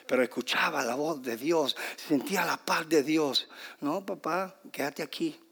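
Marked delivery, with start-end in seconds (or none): 3.8-5.4 soft reassurance. Acted. God telling the frustrated man to stay, softly reassuring.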